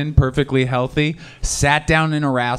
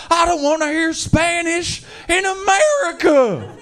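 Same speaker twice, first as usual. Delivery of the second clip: mock angry yell; 4 dB louder, slower than usual. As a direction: scream it like an outraged local. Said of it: "heard a guy yell".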